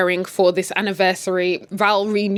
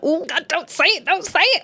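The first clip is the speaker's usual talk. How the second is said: high, pleading don't say it; mock dread; 9 semitones higher than usual. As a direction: beg in mock panic. Dreading the mistress's words.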